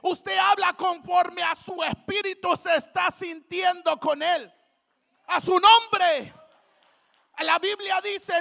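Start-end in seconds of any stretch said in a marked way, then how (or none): 5.3-6.3 loud, drawn-out call for response; rallying shout. Real, not acted. Preacher prompting the congregation's response, rallying shout. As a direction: cry it out slowly to the crowd.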